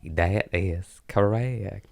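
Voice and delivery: slow, drawn "That is correct" with a slight accent; a mild put-on accent, slow; accent slip. Shown as a demonstration. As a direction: let the accent creep into "That is correct". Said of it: "It's just in the D N A now".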